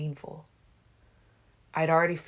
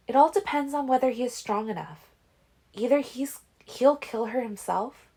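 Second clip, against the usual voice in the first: put-on higher, grave young woman; Alice's voice; 8 semitones higher, 4 dB louder than usual. A narrator's reading. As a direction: foretell it gravely. Alice foretelling grimly.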